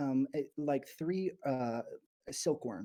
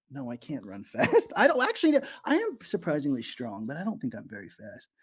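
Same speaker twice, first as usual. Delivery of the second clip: usual speaking voice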